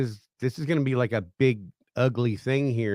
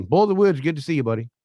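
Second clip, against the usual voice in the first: excited greeting; 6 semitones higher, 5 dB louder, faster than usual. Real, not acted. Greeting a viewer in chat.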